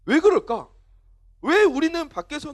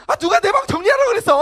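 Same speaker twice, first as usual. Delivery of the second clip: loud, high, hammed-up indignation; angry teen outburst; 11 semitones higher, 9 dB louder than usual. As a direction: yell at parents like an outraged teenager. Acted teen storming out of room.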